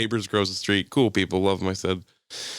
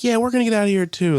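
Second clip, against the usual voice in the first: high, louder quoted reply; imitated departing neighbors; 12 semitones higher, 5 dB louder, faster than usual. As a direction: mimic them calling back as they leave. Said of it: "and they're like".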